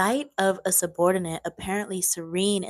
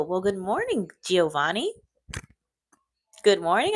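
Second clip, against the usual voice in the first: ordinary delivery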